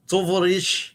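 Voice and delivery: a put-on, hammed-up Russian accent; mock Russian accent. Shown as a demonstration. Showing his co-host a bad Russian accent. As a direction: growl the greeting like a movie Russian comrade. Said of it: "I've got the Russian accent".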